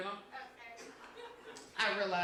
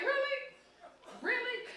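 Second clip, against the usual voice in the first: squealed high, teasing; mock-flirty high-pitched really; 13 semitones higher than usual.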